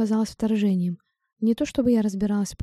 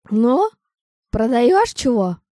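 put-on rough cawing voice, heavy Irish accent; slow, loud and croaky, strong Irish brogue; croaky Irish old woman; 3 semitones higher, 8 dB louder, much slower than usual. A narrator's reading. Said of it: "её сильный ирландский акцент". (грубый каркающий голос с ирландским акцентом)

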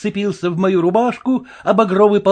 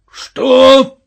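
loud, higher, indignant question; outraged; 9 semitones higher, 9 dB louder than usual. A narrator's reading. Red-faced colonel turning on the Cossack, outraged. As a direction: explode in disbelief. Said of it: "повернулся к нему полковник с багровым лицом".

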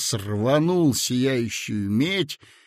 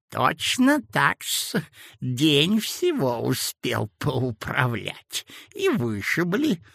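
put-on sweet-talking sinister old man; sly old man; 2 semitones higher, slower than usual. A narrator's reading. Old clerk character voice. (вкрадчивый зловещий старичок)

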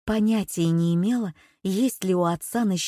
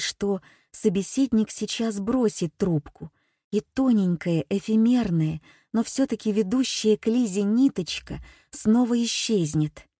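plain reading voice

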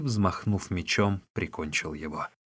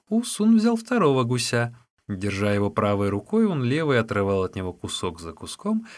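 ordinary delivery, nothing marked

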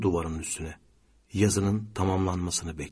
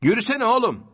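high, loud, impatient order; scolding; 14 semitones higher, 5 dB louder than usual. A narrator's reading. Passenger scolds slowing driver, sharply. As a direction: snap at someone to get moving. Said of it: "şoförüne çıkıştı".

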